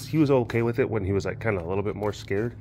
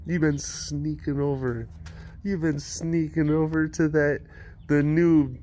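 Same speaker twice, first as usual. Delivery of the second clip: slow, higher accusing voice; accusing wife; 6 semitones higher, slower than usual. Retold or imitated. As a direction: drag out the accusation. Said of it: "And she's like".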